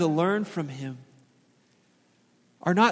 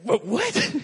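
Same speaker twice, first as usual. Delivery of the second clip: higher, baffled double take; mock surprise; 6 semitones higher than usual. Dad stunned by his daughter's remark, mock surprise. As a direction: act startled by a toddler's logic. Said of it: "And you're like".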